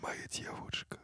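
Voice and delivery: playful seductive whisper in Russian; intimate whisper. Pretend. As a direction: breathe it softly as a sweet nothing. Whispered Russian endearments to co-host.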